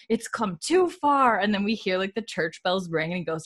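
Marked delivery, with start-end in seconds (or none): 0.1-1.4 drawn-out higher complaint; lightly hammed scandalized shopkeeper; mock indignant Jordy. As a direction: stretch it like a fed-up prude. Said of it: "Jordy's like".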